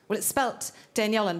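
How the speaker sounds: normal talking voice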